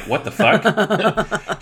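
slow, high, laughing "What the fuck?"; incredulous laughing outburst at the guards; drawn-out disbelief. Retold or imitated. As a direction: stretch it out in amused disbelief. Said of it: "which I'm like".

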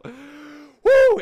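loud high whoop of joy; excited whoop. Real, not acted. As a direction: release a joyful whoop. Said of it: "I had to let it out".

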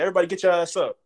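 high, loud imitated coach command; mock coach order. Freshman copying the strength coach to impress her, high loud mock order. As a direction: copy the coach to suck up to her. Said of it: "Trying to be like teacher's pet".

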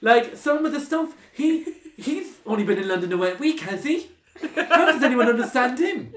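high, loud mock mum voice; imitating mum. Mum meeting the Cork housemate, high mocking voice. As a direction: squeak it out like a scandalised Irish mammy. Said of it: "she was like".

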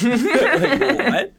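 cracking up in a squeal; laughing high. Real, not acted. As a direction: laugh through the words. Laughing after his impression.

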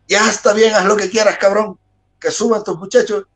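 high-pitched fed-up concession; exasperated giving in. Retold or imitated. Retold promoter giving in, exasperated.

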